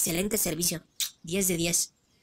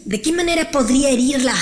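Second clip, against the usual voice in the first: loud scheming; 5 semitones higher, 9 dB louder than usual. Acted. Villain plotting revenge.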